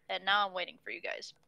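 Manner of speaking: ordinary talk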